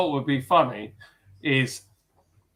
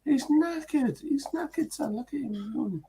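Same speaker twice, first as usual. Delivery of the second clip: high, soft put-on voice; mock Cockney; 11 semitones higher, 6 dB quieter than usual. Voicing the tired beer review pigeon, lightly Cockney. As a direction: coo it like a worn-out pigeon.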